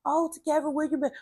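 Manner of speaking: high-pitched imitated surprise at seeing someone; surprised greeting. Church folk greeting her in the van, imitated surprised greeting.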